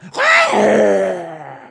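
long, high, loud monster shriek; owlbear screech. Acted. Game master voicing the charging owlbear, monster screech. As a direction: let out a piercing creature cry. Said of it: "just letting out a".

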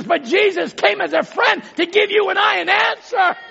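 high, loud shouted preaching; full-force preaching. Real, not acted. Preacher tells congregation Jesus came with an answer, full-force preaching. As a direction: drive each phrase out at a high-pitched shout.

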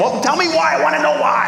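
high, mock childish pleading; insistent kid. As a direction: imitate a child demanding answers. Himself as insistent questioning child.

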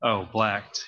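usual speaking voice

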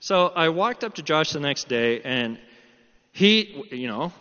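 ordinary talk

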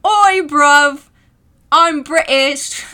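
loud mock cockney British shout; hammed-up cockney British yell; bad British accent. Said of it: "my British accent".

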